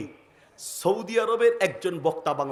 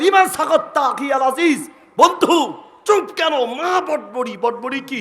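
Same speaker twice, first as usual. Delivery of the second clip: high, loud insistent questioning; pressing Saudi speaker; 6 semitones higher, 6 dB louder than usual. Retold or imitated. Saudi speaker demanding an answer from the translator, high and loud.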